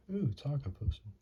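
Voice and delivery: normal talking voice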